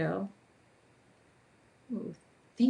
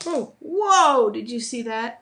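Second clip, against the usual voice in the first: loud, high whoa, then easing off; real startled exclamation breaking into a laugh; 5 semitones higher, 9 dB louder, slower than usual. Real, not acted. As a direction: blurt it in real surprise at a near mishap. Marker flips out of hand.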